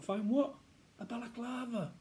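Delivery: put-on high-pitched kid voices; puzzled question, then insistent answer; schoolboy exchange. Boys discuss searching the attic, puzzled then insistent.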